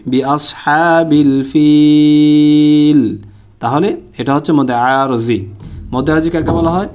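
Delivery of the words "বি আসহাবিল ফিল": chanted phrase with ফিল held very long; slow Quran demo ending on a sustained ফিল; drawn-out pausal ending. Shown as a demonstration. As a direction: stretch the ee of ফিল for three beats, then stop. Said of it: "এখানে যদি ওয়াকফ করি".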